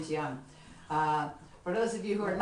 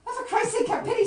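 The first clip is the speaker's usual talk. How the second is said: pleading; 9 dB louder than usual. Acted. Narrator begs seeing hell sign.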